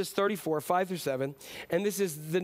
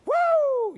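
loud, much higher whoop; mock wrestler woo; 7 dB louder than usual. Preacher mocks a showboating God, loud woo. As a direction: whoop like a showman entering the ring. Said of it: "God's not coming out of heaven going".

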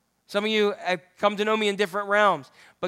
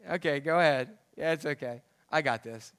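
amused God; 6 semitones lower, 6 dB quieter than usual. Retold or imitated. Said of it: "he gets a chuckle about it and goes".

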